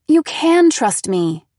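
loud, slow, insistent; shaking with anger. A narrator's reading. Daughter pleading, angry.

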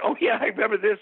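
the memory comes back; recognition. Retold or imitated. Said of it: "I was like".

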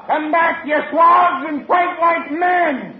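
high shouted sailor's taunt; angry challenge. Acted. Sailor yells after the men running from a fight, angry challenge. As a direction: bellow after the fleeing cowards, drawn out.

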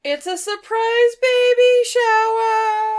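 loud, high, slow hammed-up reveal; mock announcer reveal. Husband springing the surprise party twist on the listener, hammed-up and loud. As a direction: belt it out like a game show host.

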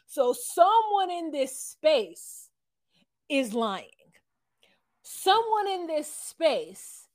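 high, slow, dramatic accusing voice; mock accusation. Boyfriend accusing someone in the room, drawn-out and hammed up. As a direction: draw it out like a staged accusation.

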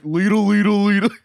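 high cartoon babble; imitated cartoon nonsense chant; Patrick. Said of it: "and he's like".

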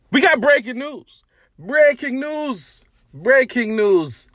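high, drawn-out mock news bulletin; breaking news announcement. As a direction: announce it big, stretching the words out.

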